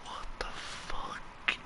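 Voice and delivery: slow, drawn-out whisper; stunned whisper. Real, not acted. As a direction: whisper it slowly in disbelief. Creeped out in the game.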